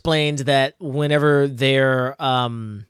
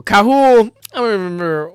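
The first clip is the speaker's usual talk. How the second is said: laughing cringe; 7 semitones higher, 6 dB louder than usual. Real, not acted. Cringing at her own naming.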